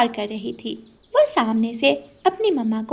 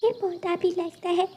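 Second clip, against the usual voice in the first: put-on higher, soft sad child's voice; sad little girl; 6 semitones higher, 7 dB quieter than usual. Girl talking to a wilted plant, softer sad childlike. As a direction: speak tenderly to the drooping plant.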